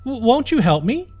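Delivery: a frightened princess begs for help; stammering plea. A narrator's reading. Princess pleading with knight.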